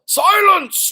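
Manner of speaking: high, loud command; roared. Acted. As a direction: roar it down over the crowd. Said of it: "roared the most very honored head mouse".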